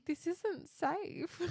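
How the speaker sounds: high slow mock alarm. Mock reaction to giant bull.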